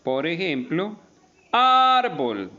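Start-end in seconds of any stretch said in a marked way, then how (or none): 1.5-2.5 "árbol" with the first "ár" long, high and loud; stress on penultimate syllable. Shown as a demonstration. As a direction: belt out the "ár" of "árbol", then drop on "bol". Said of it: "tiene acento en la penúltima sílaba". ("árbol" con "ár" alargado, agudo y fuerte)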